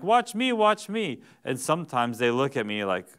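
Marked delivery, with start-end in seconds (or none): 0.0-1.2 put-on high, slow kid plea for attention; high, drawn-out child's call; eager kid. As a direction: beg like a small child wanting to be seen. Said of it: "my kids are like".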